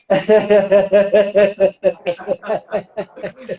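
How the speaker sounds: long, rhythmic forced laugh; demonstrated laughter. Shown as a demonstration. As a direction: keep a laugh rolling on until the breath runs out. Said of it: "I start laughing".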